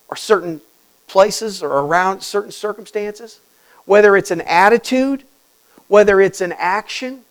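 ordinary talk

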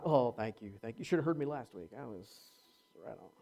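low, soft, self-satisfied thank you; mock a proud performer brushing off praise; smug. Shown as a demonstration. Preacher mocks the wrong attitude, lightly smug. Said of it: "See the difference? Not".

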